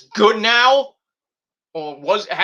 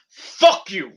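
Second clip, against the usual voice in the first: defiant retort. Pretend. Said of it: "You know what I would say?".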